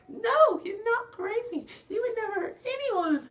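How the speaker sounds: very high, loud falsetto heroine; piercingly high mock pleading; Belle. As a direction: squeal it as a hammed-up damsel impression. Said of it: "You guys like my Belle impression voice?".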